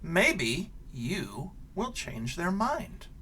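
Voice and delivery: lively picture-book read-aloud; reading to children. Acted. Teacher reading a picture book to her class, animated storytelling.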